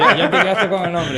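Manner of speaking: laughing it out, high and loud; teasing the host through real laughter. Real, not acted. Guest laughing as he teases the host about remembering Pamela's name.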